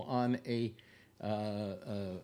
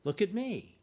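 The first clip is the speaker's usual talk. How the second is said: much higher, sing-song Look at me; playful look at me; 9 semitones higher, 3 dB louder than usual. Pretend. Vlogger teasing his viewers, much higher playful voice. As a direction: pipe up like a kid wanting attention.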